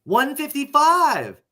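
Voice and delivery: high, slow, drawn-out disbelief; incredulous. Real, not acted. Co-host repeats last time's betting line, incredulous. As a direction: repeat the figure as if it's absurd.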